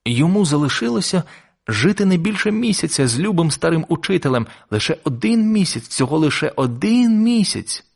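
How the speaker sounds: high, anguished reading, slowing at the end; distressed lament. A narrator's reading. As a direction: dwell on it in mounting dismay. Boy dwells on teacher's month left.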